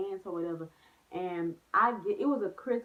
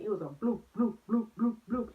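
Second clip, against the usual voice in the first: rising, louder bloops for each tumble; bloop sounds; 3 semitones higher, 3 dB louder than usual. Retold or imitated. Retelling the jeep flipping down the stairs, loud bouncy bloops. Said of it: "started flipping".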